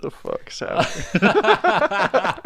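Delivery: high, slow, laughing; cracking up mid-question; laughing disbelief. Real, not acted. Laughing at his co-host's bathroom joke.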